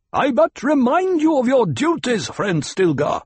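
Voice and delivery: high, loud reminder from above; insistent call. A narrator's reading. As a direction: call it down pointedly. Voice from rocks argues down.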